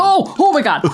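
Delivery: high gasping oh my God; shocked disgust. Real, not acted. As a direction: recoil in horror at the smell. Reacting to the awful smell.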